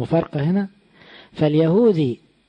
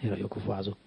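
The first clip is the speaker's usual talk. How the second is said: much softer, gossipy murmur; muttered aside; 5 semitones lower, 11 dB quieter, much faster than usual. Retold or imitated. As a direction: drop it to a sly mutter. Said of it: "ويقولوا".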